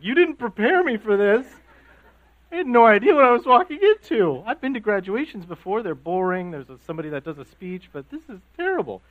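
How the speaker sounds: high, loud, half-laughing complaint; playfully overwhelmed, blaming dad; overwhelmed outcry. Retold or imitated. Crying, retelling outburst to his dad.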